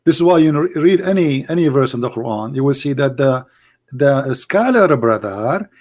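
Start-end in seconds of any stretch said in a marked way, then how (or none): none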